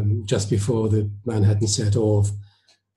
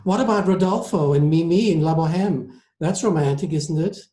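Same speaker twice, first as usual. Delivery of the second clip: higher-pitched admiring lady; eager lady passenger; 7 semitones higher than usual. Acted. Passenger argues opera is romantic, higher lady's voice. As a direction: press the point as a gushing opera fan.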